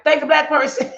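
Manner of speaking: high, loud punchy tagline; catchphrase shout. Pretend. To her viewers, pitching the series title, loud and high. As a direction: sell the hook of the series. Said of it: "But I'm like".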